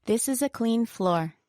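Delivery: neutral statement, falling on "floor"; downward intonation. Shown as a demonstration. Co-teacher giving a sample sentence as plain fact, falling intonation on "floor". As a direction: read "floor" with the pitch going down, as a fact. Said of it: "with a downward intonation on floor".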